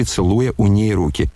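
ordinary delivery, nothing marked

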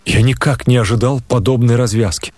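usual speaking voice

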